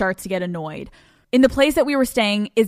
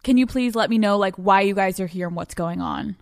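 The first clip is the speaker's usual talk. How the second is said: ordinary talk, nothing marked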